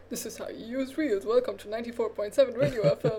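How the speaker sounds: fast, bright radio host read; radio jockey voice. Shown as a demonstration. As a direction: announce it like an FM DJ. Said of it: "It's the perfect voice for a radio jockey".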